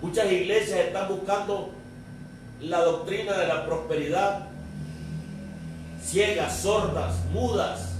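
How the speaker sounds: impassioned preaching. Acted. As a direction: press each question on the congregation. Preaches rebuke to churches. (predicación apasionada, con voz alzada)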